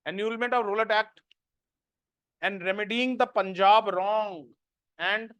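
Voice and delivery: slow, spaced dictation with long pauses. Acted. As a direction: dictate each phrase slowly so students can write it down. Dictating notes to students. (धीमी आवाज़ में notes लिखवाना)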